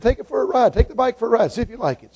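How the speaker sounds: high-pitched, light urging; eager offer. Acted. Preacher offering a boy a test ride, high-pitched coaxing. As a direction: coax him to give it a try, high and easy.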